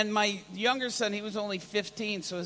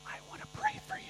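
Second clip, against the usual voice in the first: very soft, furtive whisper; timid whisper; 11 dB quieter, much faster than usual. Retold or imitated. Timid believer to stranger at mall, secretive whisper. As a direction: whisper so nobody else hears. Embarrassed to offer prayer publicly.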